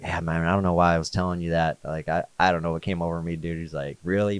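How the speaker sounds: soft, unsure and backing off; quietly doubting your own words; doubtful. Retold or imitated. Friend doubts the vision he shared, softly unsure. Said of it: "now he has doubt in his voice".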